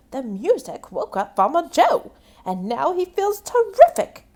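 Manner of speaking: high, loud happy news; cheerful farmer. Acted. Farmer Kate telling the turkeys, high and loud. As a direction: beam with joy. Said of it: "Farmer Kate said".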